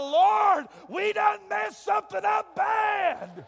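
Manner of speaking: high, loud horrified cry to the Lord; mock alarm. Father shocked at newborn's head, yelling in mock horror. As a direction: shout it like the baby came out wrong. Panicked thought at ugly baby.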